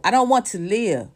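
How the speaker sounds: ordinary talk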